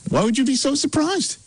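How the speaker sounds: high-pitched feigned innocence; high-voiced mock puzzlement. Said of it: "Pretty high".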